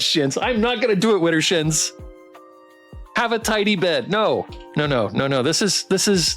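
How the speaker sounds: usual speaking voice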